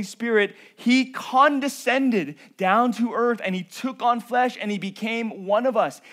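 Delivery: fervent pulpit proclamation; preaching at full force. Acted. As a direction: proclaim his glory, building to the King of Kings. Builds to full-force preaching climax.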